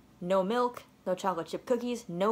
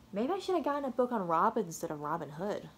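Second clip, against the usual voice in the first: usual speaking voice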